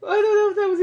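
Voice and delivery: loud, high laughing exclamation; real laughter, voice way up. Real, not acted. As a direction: gasp it out still laughing. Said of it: "I was gone for a good minute".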